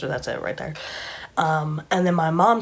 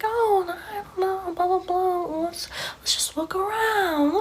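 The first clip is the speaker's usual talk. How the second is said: very high, drawn-out mock-innocent voice; feigned innocence; 13 semitones higher, slower than usual. Woman hiding what she did, high mock innocence. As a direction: play dumb in a high, airy imitation. Villain feigns ignorance, retold.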